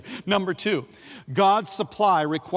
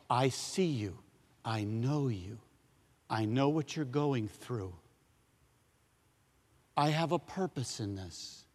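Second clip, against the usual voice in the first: tender divine voice; 5 semitones lower, 9 dB quieter than usual. Acted. Voicing God tenderly.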